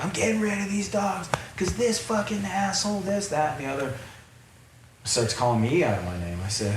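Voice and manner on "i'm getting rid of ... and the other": much higher, scornful mock rant; high mocking impression of a kid cursing; mocking the angry kid. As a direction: parody a mouthy twenty-one-year-old ranting. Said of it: "starts mouthing off".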